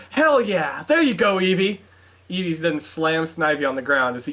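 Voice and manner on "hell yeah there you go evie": higher-pitched excited cheer; jubilant cheer. A narrator's reading. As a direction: cheer on your battle partner, pitched up. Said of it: "John jumps in the air".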